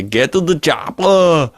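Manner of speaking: hammed-up Austrian action-hero order; Arnie accent. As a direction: Austrian accent, played for laughs. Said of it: "you could put on Arnie's accent".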